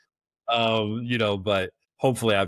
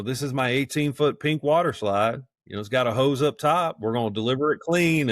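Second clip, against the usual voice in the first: put-on country accent sales pitch, pitched up; 5 semitones higher than usual. Operator pitching a water slide on video, country accent. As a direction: pitch the rental in a higher country twang. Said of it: "I'm getting a country accent here".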